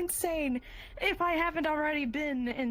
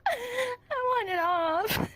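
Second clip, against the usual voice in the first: mock sobbing with laughs, higher and louder; laugh-crying; 6 semitones higher, 3 dB louder than usual. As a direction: whimper it through laughter, on the edge.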